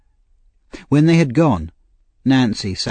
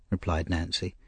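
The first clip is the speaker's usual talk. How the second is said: ordinary delivery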